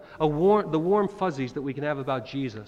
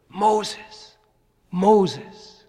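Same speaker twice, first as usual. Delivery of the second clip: voice from the bush; 5 semitones higher, 7 dB louder than usual. Retold or imitated. Voice from burning bush.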